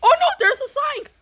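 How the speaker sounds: high, loud, fast alarm. Real, not acted. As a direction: blurt out the danger. Sudden obstacle in game.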